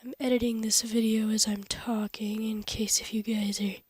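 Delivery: soft whisper while working. Real, not acted. Editing the video while talking, whispered. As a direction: whisper to yourself as you edit.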